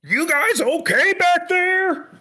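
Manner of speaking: high, slow gruff-friendly pilot call. Acted. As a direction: call back from the cockpit over the noise. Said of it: "and goes".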